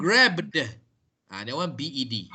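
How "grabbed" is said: "grabbed" with a voiced D released as d-uh; voiced D ending. Shown as a demonstration. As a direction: finish "grabbed" on a heavy d. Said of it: "the D sound".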